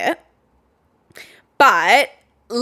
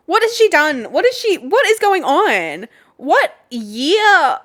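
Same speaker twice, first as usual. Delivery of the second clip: amazed; 7 semitones higher, 4 dB louder, slower than usual. Real, not acted. Continued amazement.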